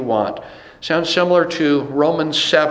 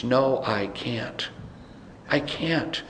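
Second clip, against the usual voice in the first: quiet admission; 4 semitones lower, 10 dB quieter, slower than usual. Real, not acted. Preacher confesses inability.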